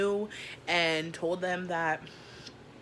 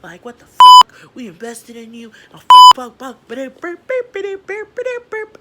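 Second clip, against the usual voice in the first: very high, very loud screamed rant; raging screaming boss; 8 semitones higher, 25 dB louder than usual. Retold or imitated. Out-of-town boss on the phone, screaming very high and loud. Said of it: "and he's like".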